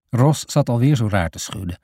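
usual speaking voice

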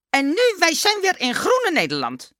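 high, loud broken-Dutch announcement; Dummy, triumphant. A narrator's reading. Dummy concluding his tale to the teacher, high triumphant voice. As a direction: wrap it up high and emphatic.

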